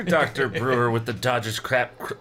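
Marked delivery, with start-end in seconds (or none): none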